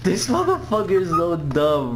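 normal talking voice

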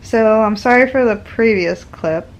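giggling through an apology; laughing. Real, not acted. Apologizing to viewers for the clip, laughing.

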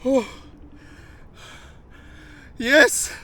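high, loud joyful yells; excited shouting. Real, not acted. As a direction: celebrate the catch out loud. Celebrating the catch. (sevinçle bağırarak)

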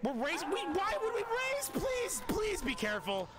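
very high-pitched, exasperated alarm; panicked pleading. Acted. Player begs companions not to race to elevators, panicked pleading. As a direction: beg them to slow down.

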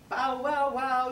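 loud dog bark bow wow. Acted. Teacher barks as the dog. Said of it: "said the dog".

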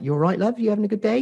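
high, singsong shop lady voice; friendly shop lady. Retold or imitated. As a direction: imitate a chatty woman behind the counter. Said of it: "the lady in the co-op".

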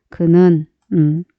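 usual speaking voice

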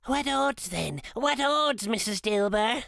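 high, cackling woman's voice; a thief dismissing guilt to Mrs Dilber; brash old woman. A narrator's reading. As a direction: toss it off like a hard old thief. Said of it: "said the woman".

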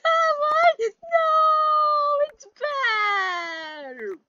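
panicked crying shout. Real, not acted. Gamer wailing as the enemy comes back, panicked. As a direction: scream like it's all going wrong.

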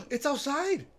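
gasp, then a high startled cry; sharp real alarm, voice jumps up; startled gasp before the words. Real, not acted. Sudden alarm in horror game.

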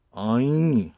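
엉유 with the first syllable held long and loud; 엉유를 길게 끌어서; drawn-out 엉유. Shown as a demonstration. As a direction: stretch the 엉 in 엉유. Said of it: "약간 길게 발음하면 됩니다".

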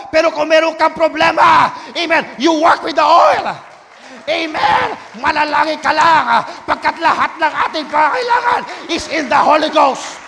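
loud, fervent preaching; preaching at full force. Real, not acted. Pastor urging the congregation about the oil, full-force preaching. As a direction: drive it home to the congregation.

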